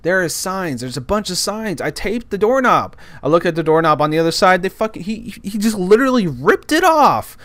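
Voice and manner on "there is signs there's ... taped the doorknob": high-pitched exasperated protest; furious. Retold or imitated. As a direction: point out the obvious, incensed. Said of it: "So I'm furious".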